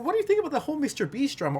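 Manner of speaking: high, fast chatty question; chatty friend voice. Retold or imitated. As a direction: rattle it off like a gossiping friend. Voicing a friend asking quickly.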